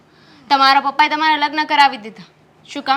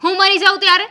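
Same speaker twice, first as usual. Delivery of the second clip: bitter retort; 5 semitones higher, 6 dB louder, faster than usual. Acted. Wife bitterly counters husband.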